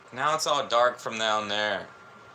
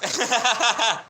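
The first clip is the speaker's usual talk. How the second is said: loud high laugh; 11 semitones higher, 5 dB louder than usual. Real, not acted. Laughing at own impression, loud and high.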